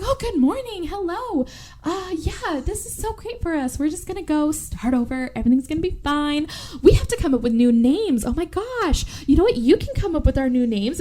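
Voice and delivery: high, chirpy put-on voice; hammed-up sing-song enthusiasm; mock cheeriness. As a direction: act out over-bright reassurance to a captive. Kidnapper acts cheerful to captive.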